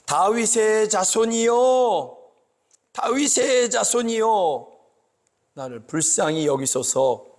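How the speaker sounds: a high, slow, stretched call of 자손이여, then a plea; crying out for mercy. Retold or imitated. Blind man cries to passing Jesus, a high, slow, desperate call. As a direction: call out across the crowd, drawing each word out. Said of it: "이렇게 부르는 것입니다".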